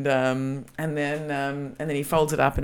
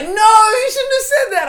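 loud, high, drawn-out wail; slow hammed-up cry of protest; anguished mock protest; 10 dB louder than usual. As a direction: wail in exaggerated dismay, imitating a rejected date. Retold date protesting a rejection.

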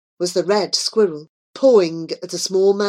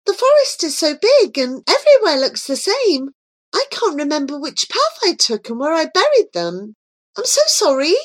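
put-on young girl; 8 semitones higher than usual. A narrator's reading. Joy pretending to be lost.

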